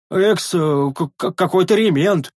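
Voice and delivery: high, stammering attempt; stumbling over word. Sentry repeating master's word, stammering. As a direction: falter through an unfamiliar word.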